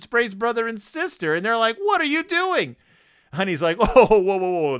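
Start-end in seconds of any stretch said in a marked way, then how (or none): 1.8-2.8 high-pitched mock outrage; indignant protest. Sprayed siblings to Honey, indignant. 3.8-4.8 loud first "whoa", then easing; startled backing-off. Acted. Honey to sprayed siblings, startled backing-off.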